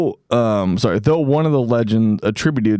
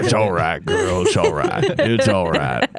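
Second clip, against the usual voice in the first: soothing a horse; 6 semitones lower than usual. Pretend.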